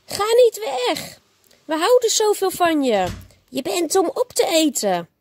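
high, pleading monsters; pleading monster cry. A narrator's reading. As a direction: beg him to stay, high and urgent. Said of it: "De Maximonsters schreeuwden".

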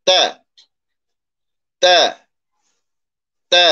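তা with a hard, firm tongue tap. Shown as a demonstration. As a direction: strike তা crisply against the teeth. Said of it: "শক্ত করে উচ্চারণ করবেন". (তা শক্ত করে উচ্চারণ)

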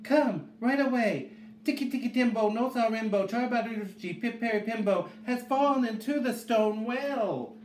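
put-on high-pitched urgent child's call; high, pressing little-boy cry; shouted. A narrator's reading. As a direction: call out the alarm high and insistent. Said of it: "shouted Chang".

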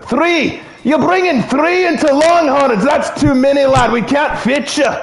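high, hammed-up Scottish brogue; Scottish accent. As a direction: play an outraged, tipsy-sounding Scottish host. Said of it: "like, a more Scottish accent".

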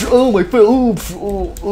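high yelps of pretend pain; mock pain cry. Player shot in a pretend battle, mock pained cries. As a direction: moan as if shot, hammed up.

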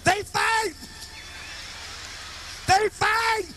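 high yelled announcement; announcement shout. Retold or imitated. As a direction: yell it out to the whole block.

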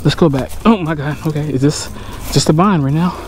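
ordinary talk

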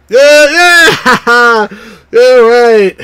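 high, loud laughing cheer; excited cheer. Real, not acted.

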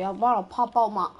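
ordinary delivery

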